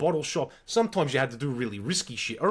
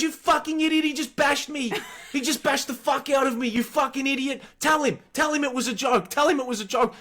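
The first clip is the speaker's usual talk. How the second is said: put-on furious girlfriend; 9 semitones higher than usual. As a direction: yell at him like she just got hit. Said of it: "She goes".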